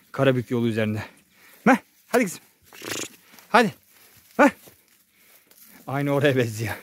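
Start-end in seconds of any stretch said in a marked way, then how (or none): 1.6-4.5 high loud herding call. Real, not acted. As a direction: urge the cow on. Calling the cow along.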